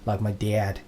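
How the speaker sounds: lightly sketched Minnesota vowel in "dad"; Minnesotan accent. Shown as a demonstration. Podcaster showing Minnesota dialect, light Minnesotan accent. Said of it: "a very Minnesotan-based thing to say".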